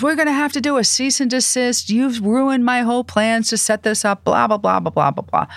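high-pitched imitated rant, easing into blahs; mock tirade that runs down at the end; mock temper. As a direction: imitate an irate executive threatening legal action. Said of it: "Italian temper".

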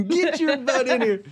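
high Southern mom scolding. Retold or imitated. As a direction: holler at the kid Southern style. Southern mom calling child.

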